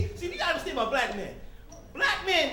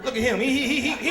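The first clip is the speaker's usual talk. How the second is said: ordinary talk, nothing marked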